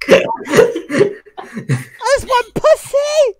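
very high, slow wail; hammed-up pleading. Prankster begging a stranger for a joke, squealed plea. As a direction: stretch it into a squealed mock plea.

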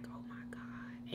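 breathy stunned whisper, almost no voice; shocked whisper. Real, not acted. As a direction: gasp it under your breath in disbelief. Shocked as the girl gets jumped.

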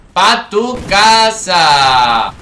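very slow, stretched taunt; drawn-out send-off. Real, not acted. Gamer sending a killed enemy home, long drawn-out taunt. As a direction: stretch every word of the send-off.

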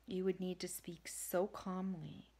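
as if calming a hurt animal; calm nighttime voice. Shown as a demonstration. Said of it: "a tone similar to this. It's calm".

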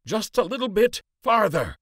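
high urging call. A narrator's reading. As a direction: keep pushing them on, calling. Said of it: "Lander called".

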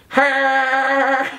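Peter Griffin voice. Pretend. Peter Griffin impression continues.

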